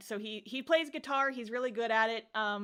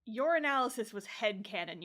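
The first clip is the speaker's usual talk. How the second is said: ordinary talk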